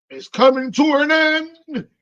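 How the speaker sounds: dramatic. Real, not acted. Dramatic announcement of ending.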